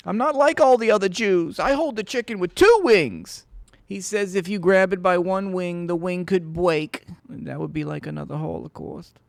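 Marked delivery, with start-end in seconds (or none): 0.1-3.5 mock quote.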